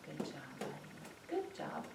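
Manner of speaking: soft, hushed praise; calm praise. Shown as a demonstration. Trainer praising an excitable puppy as they walk, calm. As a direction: keep the praise quiet and calm. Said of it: "tell him good in a calm voice".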